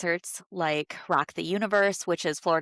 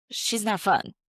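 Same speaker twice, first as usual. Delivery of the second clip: ordinary talk, nothing marked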